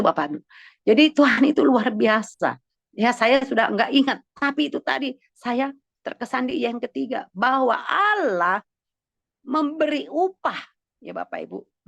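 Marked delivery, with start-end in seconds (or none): 0.8-10.6 emphatic preaching. Real, not acted. Pastor telling congregation that God gives reward, emphatic preaching. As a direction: preach it with a lifted voice, stretching the promise.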